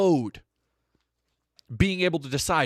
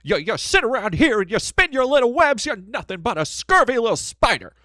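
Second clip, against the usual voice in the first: louder, higher Jimmy Stewart scolding; George Bailey; 8 semitones higher, 5 dB louder than usual. Retold or imitated. George Bailey scolding Mister Potter, in a louder higher voice. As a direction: spit the insult at the old miser.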